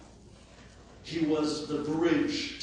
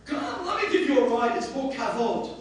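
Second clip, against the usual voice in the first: eager plea; 9 semitones higher, 6 dB louder than usual. Retold or imitated. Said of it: "I said".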